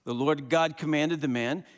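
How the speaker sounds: ordinary talk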